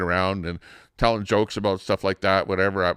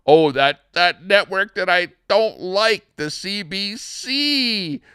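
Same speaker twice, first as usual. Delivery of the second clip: high, drawn-out mocking dismay; mock disdain; 10 semitones higher, 3 dB louder, slower than usual. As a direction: stretch the network's name out high, with exaggerated distaste. Mock disdain naming disliked broadcaster.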